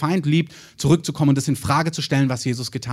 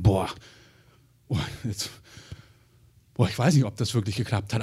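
hushed, anxious muttering to himself; doubting under his breath; imitating a worried father, much softer; 6 semitones lower, 6 dB quieter than usual. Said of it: "der ist bestimmt hingegangen".

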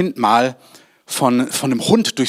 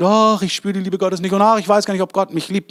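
whiny doubt; 5 semitones higher than usual. Retold or imitated. Zweifelnde Gläubige zitiert.

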